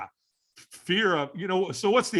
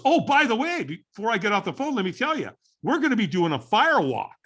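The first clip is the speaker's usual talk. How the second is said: higher-pitched imitation of a buddy casually adding news; casual afterthought, imitated; 7 semitones higher, 3 dB louder than usual. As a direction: toss it in like a by-the-way before hanging up. Buddy's casual phone afterthought, retold.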